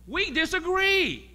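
very high, loud, slow cry; preaching climax. Acted. Preacher crying out to the congregation, high and loud. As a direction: lift it like a pulpit shout.

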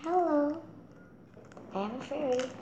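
fairy voice. Pretend. Child voices fairy toy.

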